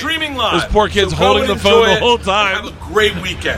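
loud, high hype-man promo; hyped promo. Retold or imitated. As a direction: pump up the Netflix special. Hyped promo video replayed.

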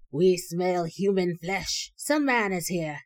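slow, loud monster growl; monsters exclaiming. Acted. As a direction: boom it out like hungry ogres.

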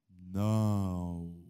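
very low, drawn-out "Não"; practised refusal. Shown as a demonstration. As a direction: intone a deep "Não" like an ancient magic word. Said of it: "praticar a palavra dos antigos dizendo o quê?". ("Não" bem grave e prolongado)

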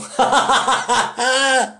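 high-pitched cackling laugh. Real, not acted. Laughing at his own retelling of the prank, high cackle. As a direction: break up laughing at the bit. Laugh after Tom Green quote.

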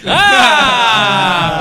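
long, loud, high "ah" yell; opening yell. Real, not acted. Podcast host opening the show, loud drawn-out yell. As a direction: belt out a drawn-out opening cry.

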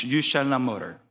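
usual speaking voice

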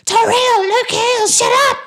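high, loud yell through the wall; angry neighbor shout. Acted. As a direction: snap at them to shut up. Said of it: "someone from the other side of the wall saying".

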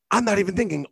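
ordinary talk

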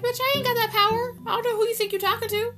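high, fast, sassy retort; sassy character quote. Retold or imitated. Recapping a soap fight, quoting the character high and fast. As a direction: snap it back high and quick like the soap character.

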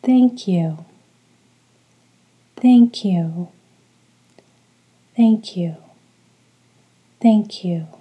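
measured, evenly repeated meditation mantra; steady call-and-repeat chant, each phrase falling away; rhythmic mantra. Shown as a demonstration. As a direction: say it for listeners to echo, pausing after each. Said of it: "repeat each word after me following the rhythm".

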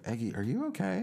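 high worried friend voice; concerned friend. Retold or imitated.

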